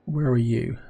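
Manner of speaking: ordinary delivery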